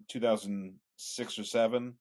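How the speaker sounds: normal talking voice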